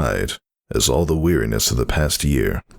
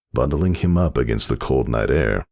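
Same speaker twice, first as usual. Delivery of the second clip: plain reading voice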